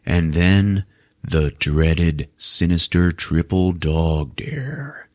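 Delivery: slow, drawn-out dramatic build; mock ominous. Narrator building up the ultimate dare, slow mock ominous. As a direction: stretch it out with hushed mock dread.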